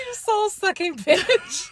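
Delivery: high, drawn-out mock insult; playful jeer, stretched and high; mocking taunt. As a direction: tease it out slowly, pitched right up. Mocking the man's accusation, laughing.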